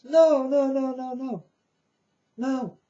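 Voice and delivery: rising, insistent refusal; emphatic denial. Real, not acted. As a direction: wave the idea off hard. Emphatic denial of wanting fame.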